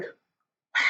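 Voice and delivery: a soft unvoiced wow, almost under the breath; breathless wow. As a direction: let the wow slip out as stunned air. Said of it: "And I was just like".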